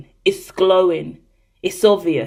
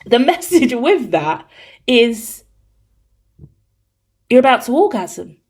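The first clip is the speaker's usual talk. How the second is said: favourite narrator voice; 2 semitones higher than usual. Pretend. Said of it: "this is my favorite voice".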